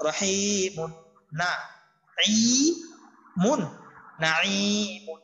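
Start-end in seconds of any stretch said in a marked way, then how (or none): none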